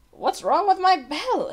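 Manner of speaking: put-on high, puzzled old bell ringer; puzzled murmur. A narrator's reading. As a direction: wonder aloud, baffled. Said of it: "he murmured".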